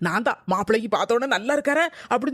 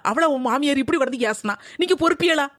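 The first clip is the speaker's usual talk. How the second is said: ordinary delivery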